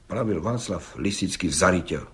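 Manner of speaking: ordinary delivery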